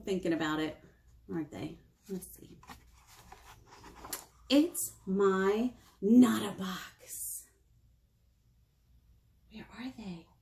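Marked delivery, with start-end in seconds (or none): 4.5-7.4 very slow, deliberate title; slow title read. Acted. Librarian reading the book title slowly. 9.5-10.3 soft whispered question. Acted. Librarian whispering while looking at the page.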